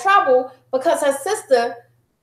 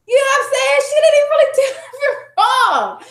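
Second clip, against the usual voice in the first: high-pitched, incredulous, breaking into laughter; laughing exasperation; 11 semitones higher than usual. Real, not acted. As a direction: protest at your own blunder with a laugh. Laughing at her own unfair parenting.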